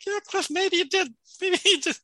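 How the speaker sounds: high, teasing put-on voice with a laugh; high-pitched playful jab at a character; mock taunt. Mock voice teasing Cliff on screen.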